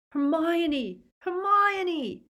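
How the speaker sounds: high, loud cry of her name; desperate calling out; anguished bellow. A narrator's reading. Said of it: "Ron was bellowing".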